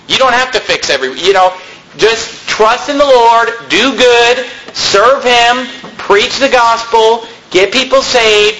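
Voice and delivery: usual speaking voice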